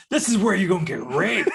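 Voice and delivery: laughing while playing the scary cop; chuckling menace that keeps cracking up; half-laughing mock threat; laughing through it. Laughing while mocking scare talk.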